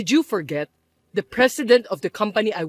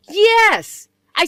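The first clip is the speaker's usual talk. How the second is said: loud, much higher yes; insistent shout; 9 semitones higher, 7 dB louder than usual. Acted. Mother insisting to her son she was wronged, shouting. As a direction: blurt it out, outraged.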